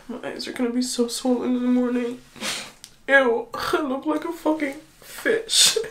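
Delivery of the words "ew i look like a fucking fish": loud, slow laugh through crying; laughing through tears. Real, not acted. Mocking her swollen crying face, laughing through tears. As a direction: laugh at yourself mid-sob.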